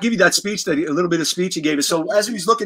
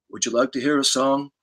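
Gene's offer; 5 semitones lower, 4 dB quieter than usual. Retold or imitated. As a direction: offer it low like a generous idol. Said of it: "And then Gene, out of nowhere".

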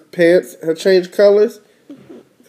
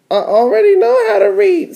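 very high little-sister character voice; faster than usual. Acted. Little sister boasting to her brother, very high voice. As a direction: pitch it way up like a cartoon kid sister. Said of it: "says D W".

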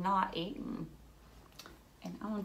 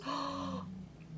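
gasp; 6 semitones higher, 8 dB quieter than usual. Pretend. Seed burns up.

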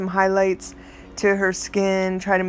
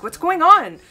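higher and louder, urgent; alarmed demand; 10 semitones higher, 6 dB louder than usual. Retold or imitated. Pressing upset grandmother on phone, higher, louder, alarmed. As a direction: push her for an answer now.

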